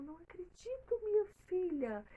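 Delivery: high, rising to loud joyful disbelief; a mother hearing wonderful news; overjoyed mother. Acted. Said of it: "ficou muito feliz e falou".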